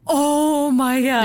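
loud, high, drawn-out exclamation; starstruck amazement. Real, not acted. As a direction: stretch it out in thrilled disbelief. Starstruck reaction to the campsite. (överväldigad förtjusning)

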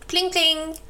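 high ringing bell imitation; bell pling. Narrator imitating the cow's bicycle bell, high and ringing. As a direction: chime it out like a little bell.

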